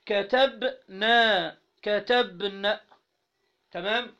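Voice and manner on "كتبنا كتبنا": very slow, drawn-out كتبتي, every syllable stretched; slow demo. Shown as a demonstration. Teacher slowly modelling كتبتي for the class. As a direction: dwell on each syllable of كتبتي. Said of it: "تقول كتبت".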